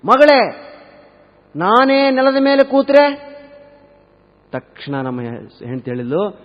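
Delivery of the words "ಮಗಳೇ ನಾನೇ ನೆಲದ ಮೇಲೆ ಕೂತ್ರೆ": loud, high, slow drawn-out question; escalating mother-in-law. Acted. Mother won't stop testing the bride, loud insistent challenge. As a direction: push it louder and higher, stretching each word.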